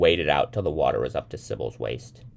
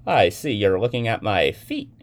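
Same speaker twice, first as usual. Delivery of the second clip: higher, louder accusing remark; confronting; 6 semitones higher, 7 dB louder than usual. A narrator's reading. Young man confronting the woman in the elevator, accusing. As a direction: challenge a stranger pointedly.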